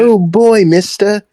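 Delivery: put-on eager kid voice. Playing the eager child.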